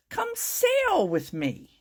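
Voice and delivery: high, slow, sung-out invitation; a whale calling gently across the sea; whale's invitation. A narrator's reading. Humpback whale invites the tiny snail, high and slow. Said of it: "said to the snail".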